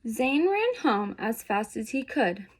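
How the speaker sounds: usual speaking voice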